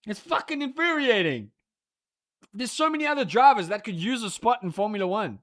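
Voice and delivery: ordinary delivery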